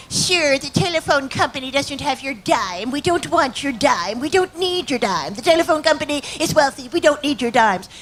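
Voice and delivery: haughty switchboard operator brushing off a caller; Elaine May's telephone operator. Retold or imitated. Operator scolding a man who lost his dime, prim and haughty. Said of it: "used to do this character".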